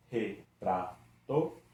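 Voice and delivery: Retrato split slowly into its syllables; separating the syllables slowly for students. Shown as a demonstration. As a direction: break Retrato apart, one beat per syllable. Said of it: "Vamos separar juntos?".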